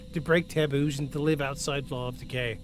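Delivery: ordinary delivery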